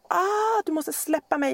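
high, strained plea to let go; begging the child to let go, high and strained; exasperated cry. Retold or imitated. Pinned down by heavy child.

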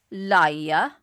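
loud one-word dismissal; blunt accusation. A narrator's reading. Enoch Davis dismissing the stranger's boast, blunt. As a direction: drop it loudly, dead certain.

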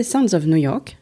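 Sounds of New York said in a light English accent; the English name pronounced in a half-English accent. Real, not acted. Host jokingly flags her English accent on the programme name. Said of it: "Vous noterez mon superbe accent anglais".

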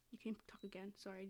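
soft unvoiced whisper. Real, not acted. Whispering an aside to her friend. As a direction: whisper it under your breath.

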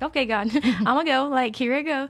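laughing and giving in; laughing surrender. Retold or imitated. Answering God's call to the altar with a laugh.

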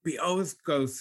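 ordinary delivery

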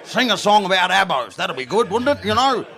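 lightly voiced Australian outback bloke; a hint of a rough Australian drinker; Australian outback accent. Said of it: "He said it in an accent".

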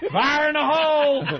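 high laughing mock blast warning; old miner yell. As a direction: holler it like a miner lighting dynamite. Joking about blasting with dynamite.

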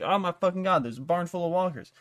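loud, high shocked outburst; shocked gasp. Retold or imitated. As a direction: blurt it out as if you just found the walkers. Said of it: "and they're like".

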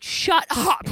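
mock-shout it like you can't believe it; disbelieving shout. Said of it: "We're like".

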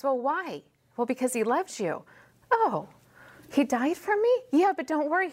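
a hint of a puzzled seeker; acted questioner. Acted exchange with new believer.

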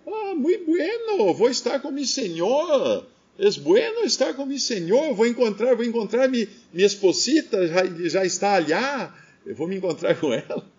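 put-on serene old man in Portuguese Spanish mix; Avelino Portuguese Spanish mix. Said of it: "E ele falou assim".

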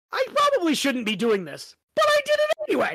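mock guilty quote rising high and fast at the end; playful Harry impression; quoting Harry. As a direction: toss off the last part in a squeaky rush. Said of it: "I love it how he says".

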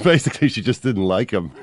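loud, high, laughing through the words. Real, not acted. Storyteller explaining the snub to friends, really laughing. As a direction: let the laugh burst through the punchline.

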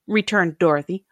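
usual speaking voice

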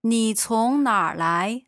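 normal talking voice

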